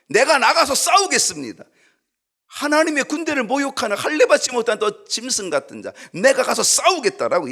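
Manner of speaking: high, loud, determined declaration; loud resolve. Acted. David loudly declaring he will fight the giant. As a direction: proclaim it boldly for all to hear. 다윗의 의지는 분명했습니다.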